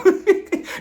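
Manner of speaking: a loud, high laugh burst; real amused laugh. Real, not acted. Laughs at her own joke.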